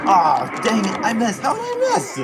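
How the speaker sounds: can't believe you missed; frustrated disbelief. Real, not acted. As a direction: voice jumps high in disbelief. Missed tackle in game.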